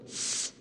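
a quiet drawn-out hiss; soft hiss. A narrator's reading. Gollum backing off from the sword, hissing. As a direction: hiss through the teeth. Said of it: "said Gollum".

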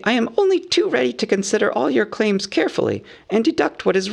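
high-pitched, businesslike hobbit voice; earnest hobbit negotiator. A narrator's reading. Bilbo warns them about Thorin, earnest and businesslike. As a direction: reason with them in a high, fussy voice.

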